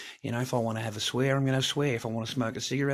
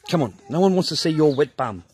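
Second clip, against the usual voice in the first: sharply raised repeat of the scold; loud telling-off; 6 semitones higher, 11 dB louder than usual. Real, not acted. Dad loudly repeats the scolding to his child in wet pants.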